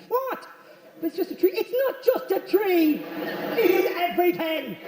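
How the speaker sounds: high-pitched, indignant exclamation; mock outrage. Acted outrage over dog at tree.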